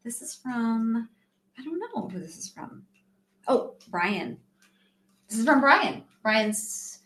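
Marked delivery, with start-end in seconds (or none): none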